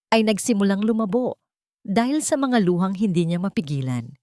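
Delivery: plain reading voice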